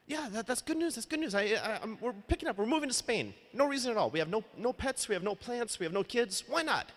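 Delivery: giddy high friend shrugging off the risks; carefree friend voiced. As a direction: toss it off like nothing could go wrong. Ryan quoted, carefree plans.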